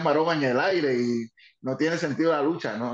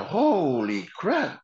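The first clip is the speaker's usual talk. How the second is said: amazed; 3 semitones higher, much slower than usual. Retold or imitated. Dick Murray amazed by crowd.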